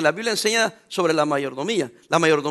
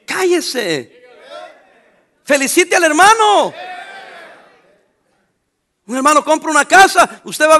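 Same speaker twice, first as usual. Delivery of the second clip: regaño firme; 7 semitones higher, 7 dB louder, slower than usual. Acted. Scolding the envious.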